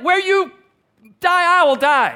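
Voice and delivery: fervent climax of the promise; impassioned vow. Acted. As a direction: cry it out at the top of the build. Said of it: "She's like".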